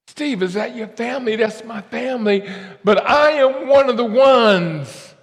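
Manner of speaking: high, loud, slow testimony; preaching at full force. Acted. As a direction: proclaim it like a revival preacher.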